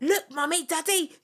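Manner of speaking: high, hammed-up Liverpool kid accent; mock Liverpool accent. Mum voicing their future kid in a mock Liverpool accent. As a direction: play the future baby in a put-on Liverpool accent. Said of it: "don't even know what accent that was".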